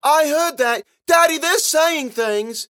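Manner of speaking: put-on very high, loud child's wail; unhappy tattling girl. A narrator's reading. Ella telling on her whispering sisters to Daddy, upset. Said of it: "Ella was not happy".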